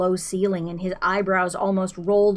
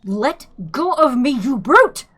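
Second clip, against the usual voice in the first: struggling protest; 6 semitones higher, 7 dB louder than usual. A narrator's reading. Struggling against the Vogon guard.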